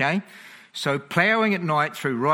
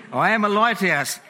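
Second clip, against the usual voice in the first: higher, louder, relishing the joke; gleeful punchline; 6 semitones higher, 5 dB louder than usual. Acted. Preacher repeating the joke to his laughing congregation, higher and louder with glee. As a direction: savour the punchline with delight.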